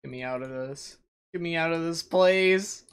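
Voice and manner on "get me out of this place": louder, pleading, punch place; loud desperate plea. Real, not acted. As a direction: cry it out in frustration. Stuck, repeats plea louder.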